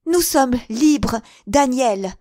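Sarah telling Daniel they are free; triumphant cry. Acted. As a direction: stretch out the joy of freedom. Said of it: "s'écria-t-elle".

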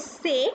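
"say" said as French letter C; slow, French "say" for the letter; French letter name. Shown as a demonstration. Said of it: "C is say".

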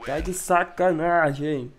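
slow, loud, fed-up groan of disbelief; exasperated disbelief. Real, not acted. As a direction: drag it out in disbelief. Frustrated gamer disbelief.

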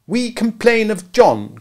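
high, loud, slow twins' complaint; cried together. A narrator's reading. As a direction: chant it like tattling twins. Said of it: "cried the twins".

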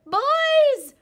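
bigger howl. A narrator's reading. Little Wolf calling into the cave, biggest howl. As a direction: howl with everything you have. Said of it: "he howled".

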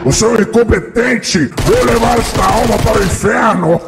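Satan gloating over a lost soul; devil voice. Acted. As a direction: voice changer demon, taunting.

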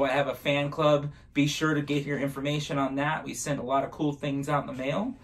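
ordinary talk

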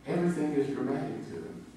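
ordinary delivery

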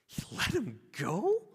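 slow, high-pitched incredulous question; acted shock. Crowd hears the servant was released, acted disbelief. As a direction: act out a stunned crowd, rising at the end. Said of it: "imagine the gasp from the crowd".

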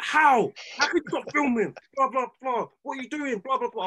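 high-pitched imitation of a furious boss; mock ballistic telling-off; went ballistic. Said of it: "my man went ballistic".

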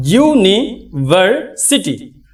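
slow "university" in its syllables; syllable split. Shown as a demonstration. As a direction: stretch "university" beat by beat. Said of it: "এগুলো ফলি syllable".

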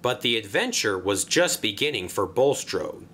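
ordinary delivery, nothing marked

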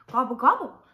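loud storybook turkey gobble; gobbling turkey. Acted.

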